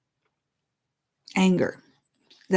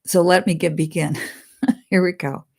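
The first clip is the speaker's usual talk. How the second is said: normal talking voice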